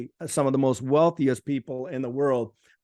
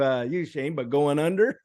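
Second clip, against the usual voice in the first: ordinary talk, nothing marked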